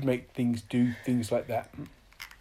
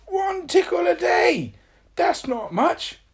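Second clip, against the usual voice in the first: dismayed protest; 7 dB louder, slower than usual. Acted. Tickle protests.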